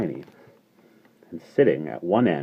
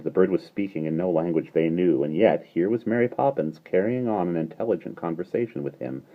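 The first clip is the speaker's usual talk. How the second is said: usual speaking voice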